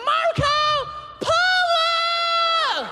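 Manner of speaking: cri aigu et étiré d'enfant; petits anglophones qui crient. Retold or imitated. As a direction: hurler « Marco Polo! » très haut et longtemps comme un enfant. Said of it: "C'est comme". (enfants anglophones hurlant à la piscine, cri aigu et étiré)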